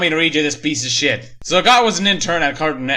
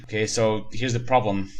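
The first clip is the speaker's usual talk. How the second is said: ordinary talk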